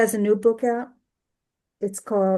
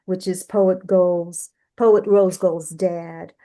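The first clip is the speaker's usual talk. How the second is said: usual speaking voice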